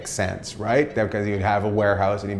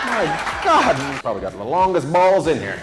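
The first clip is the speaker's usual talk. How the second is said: excited teasing; 6 semitones higher than usual. Real, not acted. Comic teasing old man.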